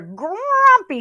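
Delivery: high, loud indignant rabbit. A narrator's reading. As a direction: fling the word back, offended. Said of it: "said Rabbit".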